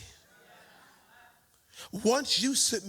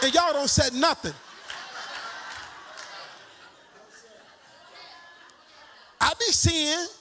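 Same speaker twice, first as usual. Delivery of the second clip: raised teasing holler over the laughter; preaching shout; 4 semitones higher than usual. Real, not acted. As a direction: call the church out with a grin. Teasing Pastor Twan, church laughing.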